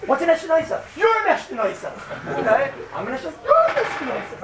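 high, playful taunt at the crowd; a preacher ribbing his audience, pitched high; teasing high voice. Pretend. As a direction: needle the crowd in a high mocking voice.

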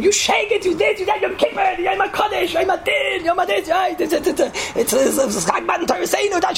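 hammed-up, very high screamed tirade; imitating a wife screaming nonstop; mock screaming. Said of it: "And she screamed".

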